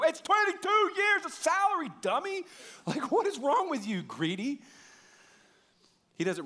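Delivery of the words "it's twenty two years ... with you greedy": high, loud mock rebuke; exasperated scolding. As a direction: shout down the one talent guy. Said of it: "shake that one talent guy and go".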